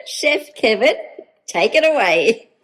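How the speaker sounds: slow, loud game-show handover; loud announcing. Acted. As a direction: announce him like a host. Hands over to chef.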